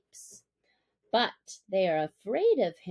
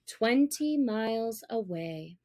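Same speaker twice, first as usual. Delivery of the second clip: plain reading voice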